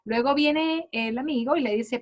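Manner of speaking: ordinary talk, nothing marked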